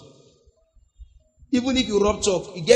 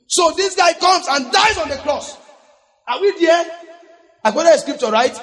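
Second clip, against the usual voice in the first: high, raised preacher's voice; preaching at full force; 7 semitones higher, 3 dB louder than usual. Real, not acted. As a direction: proclaim it to the congregation.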